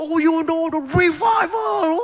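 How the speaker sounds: high, loud, slow shouted preaching; fiery revival preacher. Retold or imitated. Pastor mimicking Stephen Furtick, high shouting revival cry. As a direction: belt it out like a hyped revival preacher. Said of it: "Stephen Furtick ketika aku bilang".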